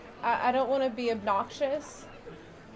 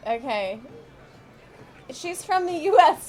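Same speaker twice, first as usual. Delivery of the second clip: ordinary talk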